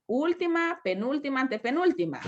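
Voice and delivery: usual speaking voice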